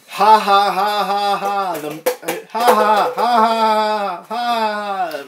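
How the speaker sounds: hammed-up mock laugh; deliberate stage laugh; fake laugh.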